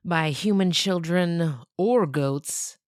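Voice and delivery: plain reading voice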